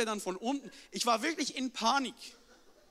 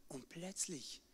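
whispered build-up to the reveal; suspense whisper; 5 semitones lower, 13 dB quieter, slower than usual. A narrator's reading. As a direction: hush it for suspense. Suspense before the big fish.

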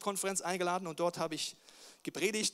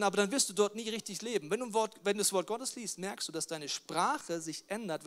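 ordinary talk

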